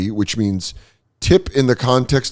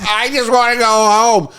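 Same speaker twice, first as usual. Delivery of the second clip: high-pitched pleading wail; mock desperation; 12 semitones higher than usual. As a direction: plead comically, at the end of your rope. Acts out himself lost, overwhelmed.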